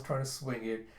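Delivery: usual speaking voice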